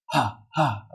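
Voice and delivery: HA, HA as bare puffs of breath; breathy H sound. Shown as a demonstration. Teacher demonstrates the little H to learners, breathy H. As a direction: puff out HA, HA, stressing the H. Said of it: "So H".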